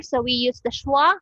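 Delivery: ordinary talk, nothing marked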